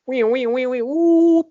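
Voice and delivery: high wailing police siren noise; siren imitation. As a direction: mimic a siren, rising to a whoop. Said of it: "like, sirens".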